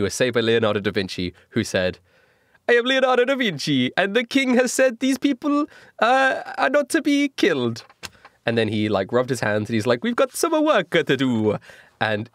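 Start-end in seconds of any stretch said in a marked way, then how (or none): 2.7-7.8 put-on high, hammy Italian accent. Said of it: "my Italian accent". 10.0-11.6 lightly voiced Italian accent; slow scheming Italian accent. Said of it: "my Italian accent".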